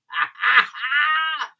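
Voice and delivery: a high burst of laughter. Real, not acted. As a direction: laugh at your own punchline. Laughs at his own story.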